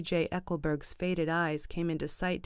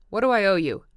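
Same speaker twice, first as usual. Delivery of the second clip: angry shout; 3 semitones higher, 11 dB louder, faster than usual. A narrator's reading. Tom to Wilson, repeating in an angry shout. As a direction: shout it now, patience gone.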